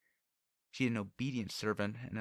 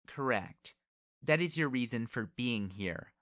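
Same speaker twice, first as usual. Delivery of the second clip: imperious and louder, pressing her point; haughty young lady cross-examining; proud, haughty girl; 3 semitones higher, 5 dB louder than usual. Acted. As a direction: lay out the logic as if from above. Haughty noblewoman's interrogation.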